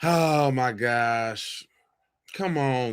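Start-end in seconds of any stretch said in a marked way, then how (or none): 0.0-1.7 slow drawn-out "oh my gosh"; exasperated. Real, not acted. Host reacting to a clip, slow exasperated sigh-words. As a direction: stretch it out in disbelief.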